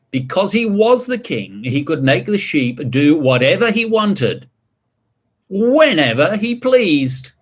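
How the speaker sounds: put-on lively read-aloud voice for children; storybook narration. A narrator's reading. Reading King Pig's story aloud to children, lively narration. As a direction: tell it like a bedtime picture book.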